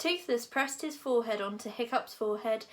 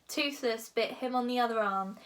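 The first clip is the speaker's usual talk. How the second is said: ordinary delivery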